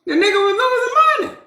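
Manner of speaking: high, fast sing-song rant; excited sing-song. Real, not acted. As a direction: sing it out mockingly, rising high.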